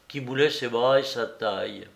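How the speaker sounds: ordinary delivery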